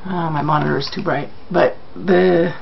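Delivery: ordinary talk, nothing marked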